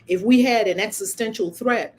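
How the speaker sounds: ordinary delivery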